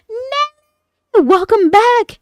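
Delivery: high, loud delighted cry; excited welcome. Real, not acted. Streamer greeting a returning viewer in chat, thrilled shout.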